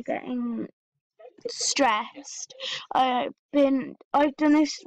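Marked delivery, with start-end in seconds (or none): 0.0-2.5 whispered stress. Real, not acted. Telling viewers she's stressed, in a whisper. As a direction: breathe the word out in a whisper. Admits she is really getting stressed.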